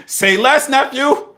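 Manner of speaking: very high, very loud hyped-up yell; excited shout. Retold or imitated. Uncle retelling his reply to nephew's return, hammed-up excited shout. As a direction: shout it like a mock rallying cry. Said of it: "I was like".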